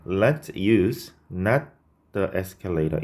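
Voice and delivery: slow, drawn-out reading of the error; reading the wrong version. Shown as a demonstration. Teacher reading the faulty sentence slowly for students. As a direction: lay out the mistake slowly, word by word. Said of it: "이상하죠?".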